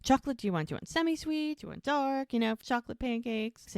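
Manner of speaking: high chipper deflecting chatter; chirpy Mel. Retold or imitated. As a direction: brush past the mood with forced sunniness. Mel chirping about pancakes to Jack.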